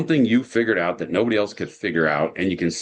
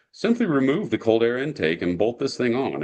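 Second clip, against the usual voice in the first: breezy higher-pitched manual voice; mock instruction read-out; reciting instructions; 5 semitones higher than usual. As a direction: mimic glib instructions, pitched up, as if it were easy. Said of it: "the instructions would say something like".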